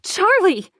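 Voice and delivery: high, loud scream of her name; panicked scream. Acted. Mother screaming her daughter's name in terror. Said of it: "I'm screaming over and over".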